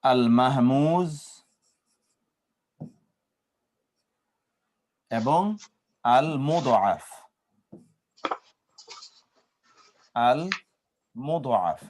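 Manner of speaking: very slow, every Arabic sound stretched; drawn-out terms. Shown as a demonstration. Teacher to students naming the verb types, very slow and stretched. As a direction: draw out al-mahmuz and al-mud'af slowly. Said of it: "as-sahih ফেল হলো তিন প্রকার".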